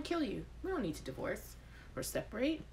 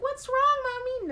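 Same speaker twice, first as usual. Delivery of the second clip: put-on child voice; 6 dB louder than usual.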